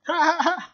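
short burst of high laughter. Acted. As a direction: laugh gleefully. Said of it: "en éclatant de rire".

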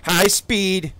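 high, loud hype call; hyped shout. Real, not acted. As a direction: announce it with hype. Hyped moment in gameplay.

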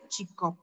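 CHICOP said softly with a weak vowel; weakened vowel. Shown as a demonstration. Teacher shows the dieresis to pupils, weak pronunciation. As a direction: say "chicop" lightly, letting it fall. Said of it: "se debe pronunciar más débil".

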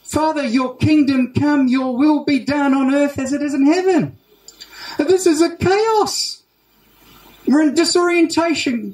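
high, urgent appeal to God; pleading prayer. Acted. Preacher prays for the kingdom amid chaos, pleading. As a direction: cry out to heaven, pitch climbing.

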